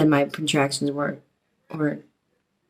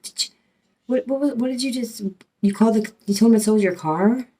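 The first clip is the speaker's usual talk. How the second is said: high, stammering disbelief building louder; pitched-up, incredulous accusation; retold indignant confrontation; 7 semitones higher, 6 dB louder than usual. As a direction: re-enact sputtering outrage, tripping over the questions. Retold confrontation over false theft report.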